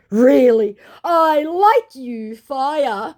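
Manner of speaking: butter him up in a silly high voice; scammer flattering. Retold or imitated. Scammer flattering the vlogger, high and loud. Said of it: "To which he said".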